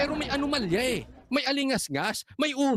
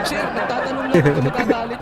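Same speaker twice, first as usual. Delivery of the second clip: loud laughing mock plea; 8 dB louder than usual. Real, not acted. Mock-innocent question to congressman.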